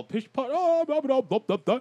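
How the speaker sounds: high mocking posh babble; a guard mocking his partner's fancy talk, in a high, mocking, posh babble; mocking posh chatter. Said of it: "being polite".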